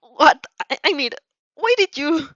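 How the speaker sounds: sudden shocked rise, voice climbing; a flustered boy's stunned outburst; flabbergasted. Acted. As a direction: caught off guard and sputtering.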